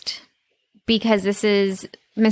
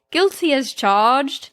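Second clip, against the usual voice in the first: mock British accent; 4 semitones higher, slower than usual. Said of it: "He says".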